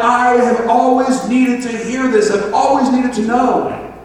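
loud, high, drawn-out amazement; amazed outburst. Acted. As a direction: exclaim it like long-awaited good news. Acts out amazed gospel listener.

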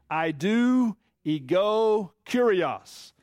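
"I do ego kurios" drawn out, each syllable slow; slow Greek call-along. Shown as a demonstration. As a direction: lead the room through "I do ego kurios" slowly. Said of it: "Say it with me".

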